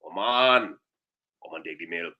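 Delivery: Trinidadian cow plea trailing off; fading Trinidadian plea. Acted. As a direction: let it sink to very soft. Cow complaint dying away.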